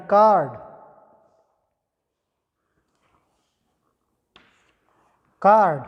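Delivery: "कार्ड" loud with a long aa; "कार्ड" said loud, aa held; model word. Shown as a demonstration. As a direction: call out "कार्ड" with the long open aa. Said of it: "example मिल जाएगा".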